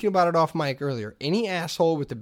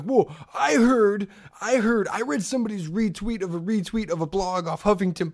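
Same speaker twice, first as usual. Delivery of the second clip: lightly hammed-up breaking news, higher-pitched; mock Twitter know-it-all; 5 semitones higher than usual. Twitter user passing on a retweeted rumor, mock self-important. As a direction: stretch the opening, then rattle on self-importantly. Mocking a typical Twitter poster.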